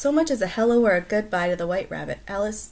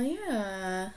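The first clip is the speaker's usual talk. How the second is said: ordinary delivery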